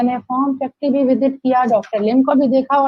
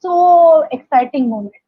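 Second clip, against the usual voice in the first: drawn-out, louder "so exciting"; excited; 2 semitones higher, 5 dB louder, much slower than usual. Real, not acted. Guest telling the host about meeting crowds on tour, excited. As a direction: stretch it out with real excitement. Reliving an exciting company event.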